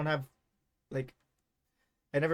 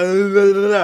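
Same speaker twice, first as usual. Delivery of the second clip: loud run-on blah-blah, tripping over the reading; tongue-tied outburst of babble; frustrated blah-blah babble; 5 semitones higher, 14 dB louder, slower than usual. Real, not acted. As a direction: blurt out loud nonsense after a stumble. Garbled reading, blurts out frustration.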